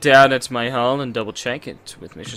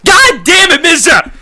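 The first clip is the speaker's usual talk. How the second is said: high, very loud exasperated yell; startled angry shout; 10 dB louder, slower than usual. Real, not acted. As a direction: curse as if badly startled. Startled, curses a character.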